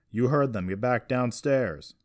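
loud, slowing command; firm order. A narrator's reading. Carson's father backing him up, ordering. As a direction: order them about with weight. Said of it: "Brandon, ordered".

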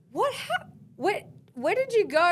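be baffled at what you see; shocked question. Retold or imitated. Shocked at naked boyfriend.

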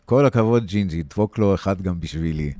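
jeering shout. Acted. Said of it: "הערבי צועק".

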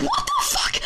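high, loud shriek; shrieking outrage. Real, not acted. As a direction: shriek in disbelief. Teammate died, outrage.

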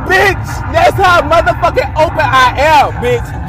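high, loud, laughing declaration; loud laughing outburst. Real, not acted. After blurting her cousin's name, a loud laughing outburst. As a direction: shout it out tickled at yourself.

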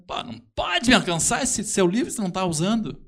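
rough irritated voice; gruff demand. Retold or imitated. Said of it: "o cara engrossou a voz".

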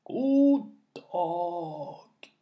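put-on extremely slow, stretched old voice; creeping, long-drawn aged speech; ancient old man. A narrator's reading. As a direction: draw each syllable out as an ancient man. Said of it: "da den gamle kallen begynte å snakke".

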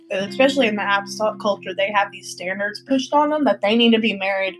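usual speaking voice

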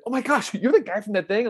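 high-pitched starstruck question; imitated excited recognition. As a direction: mimic a fan who just recognized someone. Acting out someone recognizing him.

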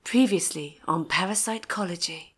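low, breathy trailer narrator; sexy narrator voice. Shown as a demonstration. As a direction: purr it like a movie trailer. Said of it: "sexy narrator voice".

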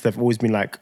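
ordinary delivery

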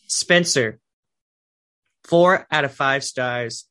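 slow, loud sign-off with the rating; announcer verdict. Acted. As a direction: announce the score like a host. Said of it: "rounding up the verdict".

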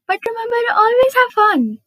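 excited. Real, not acted. Podcaster urging her listeners to enjoy, bright and excited. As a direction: lift the whole line up with eager cheer.

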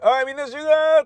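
calling everyone. Retold or imitated. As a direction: round up the crew. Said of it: "とかって".

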